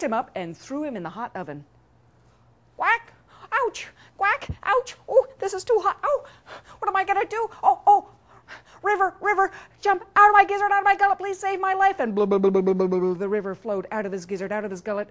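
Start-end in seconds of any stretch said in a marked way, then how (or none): 2.8-12.0 very high, loud yelps; panicked duck in pain. Acted. Duck in a hot oven begs the river, shrieking panic. 12.0-13.1 loud babbling blub-blub-blub; gushing water noise. Acted. River pours out of the duck's gizzard, loud gushing noise.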